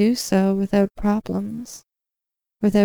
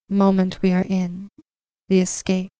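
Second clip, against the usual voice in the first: ordinary talk, nothing marked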